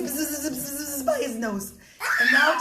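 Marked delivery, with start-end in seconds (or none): 0.0-1.0 a light buzzing zz sound, half laughing; mock mosquito buzz for the children. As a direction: imitate a mosquito buzzing close by. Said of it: "And he goes".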